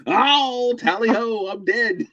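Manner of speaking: high-pitched, hammed-up dying voice; mock death cry. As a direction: play a silly, theatrical death, way up high. Jokingly acts out a death.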